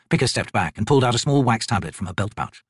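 plain reading voice

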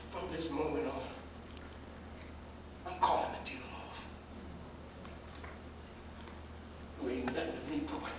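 quiet devastation. Acted. Father disowns son, devastated.

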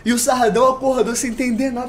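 ordinary talk, nothing marked

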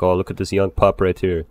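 usual speaking voice

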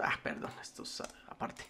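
breathy whispered aside, voice dropped away. Real, not acted. Teacher whispers a correction to himself, aside from the class. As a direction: mutter it to yourself, off the record.

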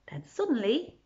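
ordinary delivery

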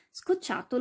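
plain reading voice

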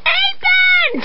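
high shrieking terror; panicked scream. Acted. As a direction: scream it, trapped and scared. Girl screams for help.

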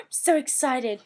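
gasp, then slow, delighted "So excited"; thrilled and breathless; gasping excitement. Real, not acted. Cousin's baby news.

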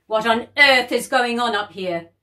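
loud, angry father bellowing up the stairs; cross dad. Acted. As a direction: tell the girls off loudly. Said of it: "now Dad was cross".

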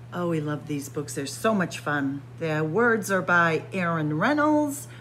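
ordinary delivery

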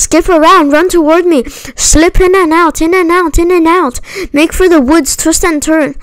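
high, urgent commanding goose; rallying high-pitched commands; giving orders. A narrator's reading. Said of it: "began to give orders".

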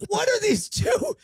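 high, slow scandalized parent; hammed-up shocked mom with family; shocked passerby. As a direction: gasp like a parent catching strangers in the park. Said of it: "Let's act it out".

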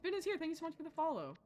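normal talking voice

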